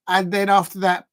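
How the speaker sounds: normal talking voice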